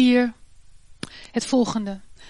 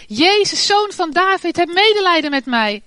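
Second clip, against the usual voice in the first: loud, higher cry across the road; shouting for mercy; 6 semitones higher, 5 dB louder than usual. A narrator's reading. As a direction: call out desperately to Jesus. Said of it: "begon hij te schreeuwen". (luid roepend om medelijden)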